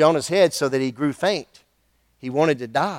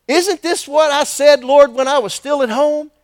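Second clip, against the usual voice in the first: Jonah protesting to God; complaining prophet; 8 semitones higher, 4 dB louder than usual. Acted.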